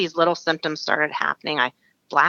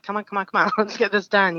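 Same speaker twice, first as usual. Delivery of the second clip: slightly higher, impatient muttered urging; mildly acted hurry-up, laugh breaking in; 4 semitones higher than usual. Patient waiting on the doctor at the appointment, impatient. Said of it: "sitting there kinda tapping my foot".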